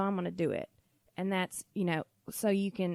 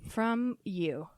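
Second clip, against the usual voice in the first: ordinary delivery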